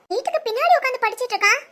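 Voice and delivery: usual speaking voice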